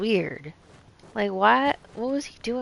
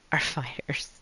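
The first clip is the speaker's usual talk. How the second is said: whispering; 5 semitones lower, 8 dB quieter than usual. Real, not acted. Aside to self.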